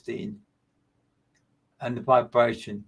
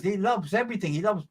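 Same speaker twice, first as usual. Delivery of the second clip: usual speaking voice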